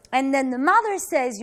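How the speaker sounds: normal talking voice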